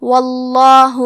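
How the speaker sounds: واللہ میں لام کو بھاری اور موٹا; اللہ کے لام کو پُر آواز سے. Shown as a demonstration. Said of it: "لام کو موٹی آواز میں پڑھیں گے". (لفظ الجلالہ کا لام موٹی آواز میں)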